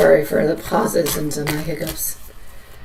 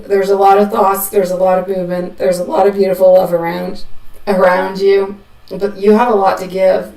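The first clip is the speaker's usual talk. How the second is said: louder, upbeat card reading; tarot reading; 2 semitones higher, 4 dB louder than usual. Acted. Reader telling Hudson his cards, louder and lively. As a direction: deal the cards out with lively energy.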